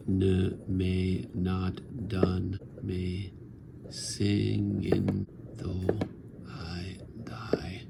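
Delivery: "Ne m'est not done me singing though" drawn out very slowly, "I die" whispered; very slow verse. Shown as a demonstration. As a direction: stretch every syllable, then let "I die" fade to a whisper. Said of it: "There's the caesura".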